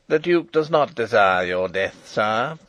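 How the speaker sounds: loud, hard jailer's voice taunting the captive king; harsh grating voice. Acted. Jailer Detchard taunting the imprisoned King, harsh and grating. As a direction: rasp it out with cold mockery. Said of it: "a harsh, grating voice".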